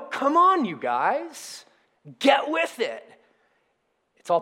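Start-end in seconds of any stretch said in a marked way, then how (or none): 0.1-3.1 mildly mock-impatient nudge, starting high; acted-out urging, pitch jumping up; half-serious chivvying of stragglers; prodding. Voices the prodding Jesus doesn't use.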